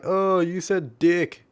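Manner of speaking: slow mocking "Oh, you said dick"; mocking commenters. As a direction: drag it out like a smug heckler. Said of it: "And people are like".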